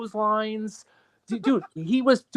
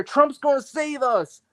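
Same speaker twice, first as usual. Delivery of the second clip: mock farmer cry, higher and drawn out; slow, raised-pitch mimicry of believers; imitating hopeful farmers; 6 semitones higher, slower than usual. Mocking farmers who trust a politician, mock hopeful cry. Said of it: "these farmers, they're out here".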